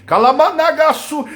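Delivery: high, loud burst of tongues; fervent tongues outburst. Real, not acted. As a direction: cry out ecstatic syllables at full force. Bursts into tongues mid-preaching. (brado forte em línguas)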